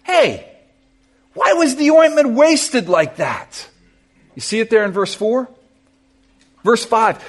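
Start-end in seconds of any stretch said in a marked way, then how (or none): 0.0-3.7 high, slow indignant protest. Retold or imitated. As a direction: complain that it was wasted. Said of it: "said to themselves indignantly".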